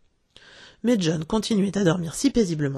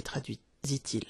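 ordinary delivery

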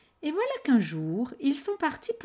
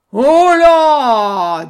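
very loud troll roar; 5 semitones higher, 18 dB louder than usual. Acted. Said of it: "dit le troll".

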